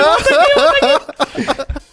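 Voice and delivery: calling him back, high and loud. Retold or imitated. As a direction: chamando alto, bem agudo. (chamando de volta, voz alta e aguda)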